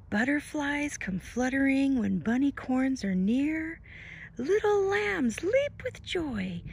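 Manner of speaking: bright sing-song storybook read; lilting picture-book narration; reading to children. Acted. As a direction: read the rhyme to a toddler with wonder. Reads picture-book rhyme.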